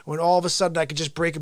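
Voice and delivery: usual speaking voice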